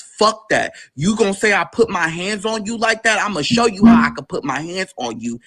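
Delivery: retold angry uncle. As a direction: imitate an uncle squaring up, moderately heated. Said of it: "He's mad as fuck".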